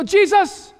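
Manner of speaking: high, loud call to wake someone; alarmed cry. Acted. Disciples wake Jesus as boat breaks apart, alarmed cry.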